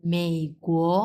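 মেই dipping third tone, কুয়ো rising second tone; Chinese tones. Shown as a demonstration. Teacher modelling মেই কুয়ো tones. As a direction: dip on মেই, rise on কুয়ো. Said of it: "কুয়োটা দুই number tone".

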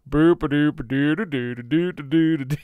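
bouncy goofy sung-out nonsense syllables; dopey tootling imitation; silly doo-doo noise. Co-host mimicking dim attendants, silly doo-doo noise.

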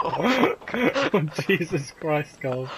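loud, high, incredulous; shocked amused outburst; laughing disbelief. Real, not acted. Reacting to friend killing dog.

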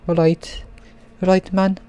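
put-on playful high-pitched maybe-Scouse accent; put-on British regional accent. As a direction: greet in a hammed-up Liverpool-like accent. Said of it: "That's a good voice".

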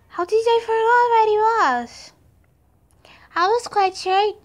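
frustrated shout. Real, not acted. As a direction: yell at yourself in frustration. Frustrated with herself.

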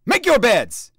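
loud, high barked order; shouted order. A narrator's reading. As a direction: bark it across the dorm. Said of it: "he ordered".

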